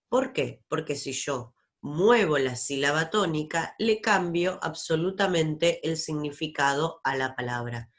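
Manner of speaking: ordinary delivery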